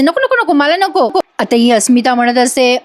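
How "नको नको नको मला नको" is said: high-pitched mock protest; imitating a reluctant patient; protesting refusal. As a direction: refuse repeatedly, pitch jumping up. Acting out Priya refusing the potion.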